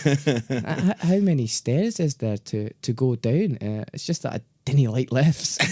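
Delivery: timid Scottish accent, imitating a friend. Friend asks tower worker for stairs, nervous Scottish accent. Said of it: "that's a good Scottish accent".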